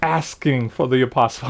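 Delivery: dropped to a near-whisper, low and soft; hushed. Real, not acted. Preacher hushing the congregation on his key point, soft and low. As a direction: lean in and say it softly, as a secret.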